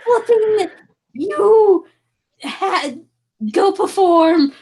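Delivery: high, halting mock awe; put-on awed voice. Player to charismatic stranger, high put-on voice. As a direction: squeal it word by word, drawn out at the end.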